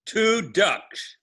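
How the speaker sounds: high, loud singsong drill; sing song rote recitation. Shown as a demonstration. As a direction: chant it out like a lesson. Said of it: "Repeat after me".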